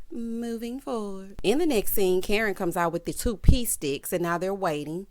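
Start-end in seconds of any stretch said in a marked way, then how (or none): 0.1-1.4 slow, softer segue; drawn-out transition. Real, not acted. Host moving the recap to the next scene, drawn-out segue. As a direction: stretch it out softly to change the subject. Recap host's catchphrase between segments.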